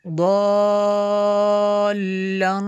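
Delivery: दल्लन with the first vowel held very long and loud; madd lazim. Shown as a demonstration. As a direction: sustain the vowel of दल्लन far beyond normal, then hum the n. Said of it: "मद लाजिम है". (दल्लन को खूब लंबा खींचकर)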